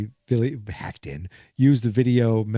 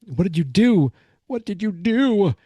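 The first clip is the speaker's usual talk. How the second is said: alarmed; 9 semitones higher than usual. Retold or imitated. Mike alarmed at Eleven.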